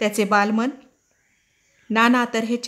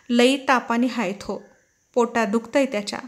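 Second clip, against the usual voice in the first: usual speaking voice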